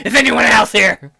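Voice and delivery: loud, mocking echo of the girl; exaggerated loud demand; judging mimicry. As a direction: blare it out like an accusation. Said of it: "She's judging".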